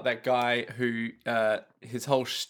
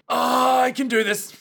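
higher, puffed-up can-do voice; hammed-up self-assurance; mock confidence; 9 semitones higher, 4 dB louder than usual. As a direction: teasingly imitate a friend's bravado. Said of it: "I just thought you were like".

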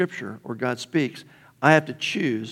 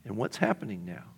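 quiet prayer; 8 dB quieter than usual. Retold or imitated. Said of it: "sit down with the parable and go".

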